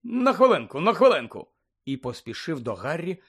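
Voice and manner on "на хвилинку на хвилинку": high, loud, offhand toss; breezy, raised-voice brush-off; brusque. A narrator's reading. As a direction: call it out unceremoniously. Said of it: "як він безцеремонно кинув гоблінам".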